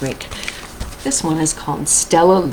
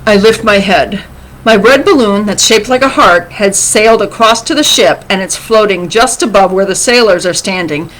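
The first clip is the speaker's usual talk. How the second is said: animated read-aloud, lifting with the drama; story reading to children; 5 semitones higher, 8 dB louder than usual. A narrator's reading. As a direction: read a picture book expressively to children. Reads a picture book aloud.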